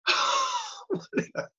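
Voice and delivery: high sneering laugh; high mocking bully laugh. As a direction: laugh her down cruelly.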